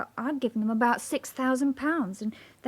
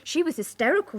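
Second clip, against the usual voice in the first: normal talking voice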